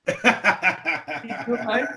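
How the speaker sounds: ordinary delivery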